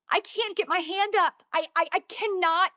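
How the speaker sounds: high-pitched, mildly distraught retelling; imitating overwhelmed distress without real tears; retold sobbing plea. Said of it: "I'm just sobbing".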